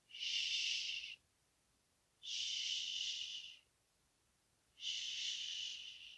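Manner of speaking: soft fading shushes; shushing. Shown as a demonstration. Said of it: "Say shh three times".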